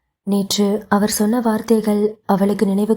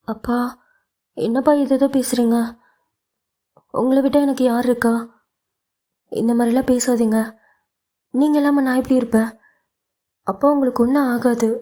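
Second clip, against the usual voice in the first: put-on young daughter, higher and pleading, near tears; frightened daughter; 4 semitones higher, faster than usual. Daughter begging her father, higher pleading voice. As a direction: beg your father not to talk of dying.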